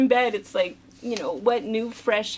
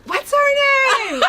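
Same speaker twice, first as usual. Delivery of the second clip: much higher, slow shouted plea; imitated shout; 7 dB louder, much slower than usual. Wife imitating her husband shouting for the device's name, mock shout. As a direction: yell it out from the other room. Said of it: "And then he'll yell".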